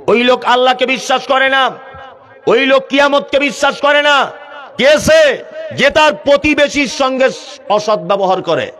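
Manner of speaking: high, ringing sermon proclamation; full-force preaching. Real, not acted. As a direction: declare it forcefully to the crowd.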